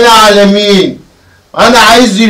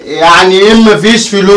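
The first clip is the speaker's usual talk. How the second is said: sharp, louder demand for money; angry demand; 2 semitones higher, 5 dB louder than usual. Acted. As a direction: bark it at the boy. Father demands money from son.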